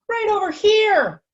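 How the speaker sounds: very high, slow falsetto. Acted. Shrunken Pancake answers in tiny falsetto. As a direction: squeak it up in falsetto. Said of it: "a falsetto voice spoke".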